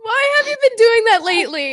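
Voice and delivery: breaking up while asking; laughing. Real, not acted. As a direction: laugh through the question.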